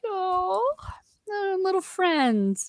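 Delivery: high, slow aww; touched cooing. Real, not acted. Podcaster moved by two hobbit friends, touched cooing. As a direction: coo fondly in a high, drawn-out voice.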